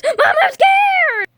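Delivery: put-on high wailing child shriek; scared scream. Child wailing to mom on the plane, high scared shriek. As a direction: cry out to mom in mock terror.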